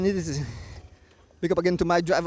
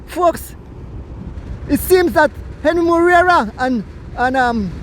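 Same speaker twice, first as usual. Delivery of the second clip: quick, raised play-by-play call; race commentary; 6 semitones higher, 8 dB louder than usual. Acted. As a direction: call the finish of the bike race live.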